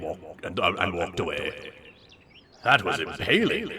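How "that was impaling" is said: ordinary talk, nothing marked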